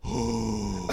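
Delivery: long puffed-out breath; winded exhale. Acted. Runner recovering beside a friend, heavy exhale. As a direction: blow out air to recover from running. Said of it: "I gotta catch my breath".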